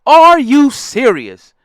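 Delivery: slow, loud, incredulous Are you serious; disbelief. Real, not acted. Ranting at a host's interview, stunned and loud. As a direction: drag it out in pure disbelief.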